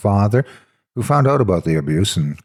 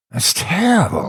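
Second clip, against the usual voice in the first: shocked gasp, pitched up; horrified at the story, a gasp; 9 semitones higher than usual. A narrator's reading. Narrator gasps at the news, shocked. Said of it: "I gasped".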